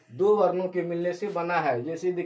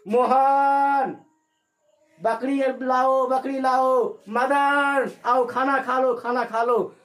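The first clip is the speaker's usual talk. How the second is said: long drawn-out calls, much higher and louder; calling out; 6 semitones higher, 6 dB louder, much slower than usual. Shown as a demonstration. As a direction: call across a field, stretching each name. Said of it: "जैसे हम कहते हैं". (दूर से ज़ोर से खींचकर पुकारना)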